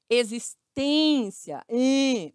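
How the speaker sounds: existência slowly, with a long closed ê. Shown as a demonstration. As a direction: stretch the stressed ên in existência, then isolate em.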